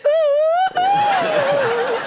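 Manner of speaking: piercing falsetto hoots; imitated high oohs. Prince behind him seeking attention, high hooted oohs. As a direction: hoot high to be noticed. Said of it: "What's that noise?".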